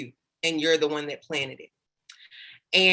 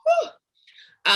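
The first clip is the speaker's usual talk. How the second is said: emotional whew; 4 dB louder than usual. Real, not acted. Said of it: "trying not to sweat and cry".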